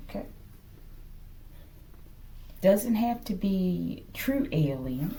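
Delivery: ordinary talk, nothing marked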